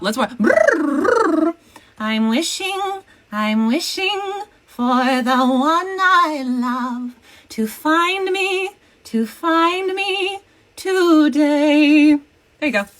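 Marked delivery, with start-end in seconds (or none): 0.4-1.5 lip trill warm-up. Shown as a demonstration. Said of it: "Warm up". 2.0-12.1 slow, high, sung princess voice. Retold or imitated.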